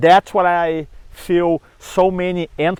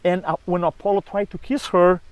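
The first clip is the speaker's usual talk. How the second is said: ordinary talk, nothing marked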